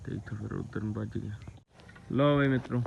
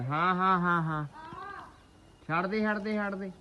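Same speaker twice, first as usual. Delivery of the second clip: shooing call; 10 semitones higher than usual. Real, not acted. Shooing birds in the field.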